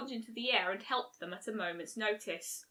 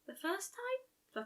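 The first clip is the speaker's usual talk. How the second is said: high, slow, soft and hesitant; timid Fluttershy question; 9 semitones higher, 9 dB quieter, much slower than usual. A narrator's reading. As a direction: ask it shyly, drawing it out.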